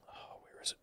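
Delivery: whispered aside to yourself while searching; very soft, voiceless self-talk; barely audible, hunting for something; under the breath. Real, not acted.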